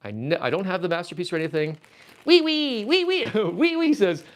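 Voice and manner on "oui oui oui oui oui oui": much higher, sing-song mock French oui oui; viewer's comment read in a silly French accent. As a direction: ham up a cartoon Frenchman. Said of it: "says Transformers Joe".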